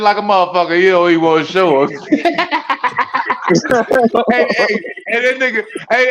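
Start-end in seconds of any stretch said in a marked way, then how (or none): 2.0-4.2 long, high burst of laughter; laughing at own joke. Real, not acted. Roaster after the withdrawal joke, high real laughter. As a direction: lose it after the punchline.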